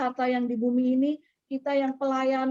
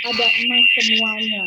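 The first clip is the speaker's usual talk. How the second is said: a loud, strange voice booming the words; voice of the Holy Spirit; 10 dB louder than usual. Retold or imitated. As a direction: announce it like a voice from above. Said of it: "Roh Kudus bilang lagi".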